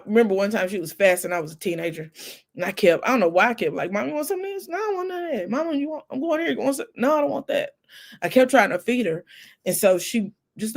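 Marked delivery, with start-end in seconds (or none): 3.9-4.7 put-on teen self. Teen self offering mom food. 4.7-5.5 imitating mom. Fasting mom refusing food. 5.5-6.9 put-on teen self. Teen self offering again. 7.0-7.7 imitating mom. Mom refusing.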